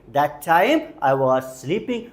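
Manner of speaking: slow, even teacher repeat. Shown as a demonstration. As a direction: say it slowly again for the class. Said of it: "कोशिश करते रहिए". (धीमी दोहराहट)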